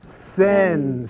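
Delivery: slow, emphatic Italian; Italian accent. Shown as a demonstration. Speaker getting the audience to repeat, showy Italian accent. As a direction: lead a chant with big Italian syllables. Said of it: "with your best Italian accent".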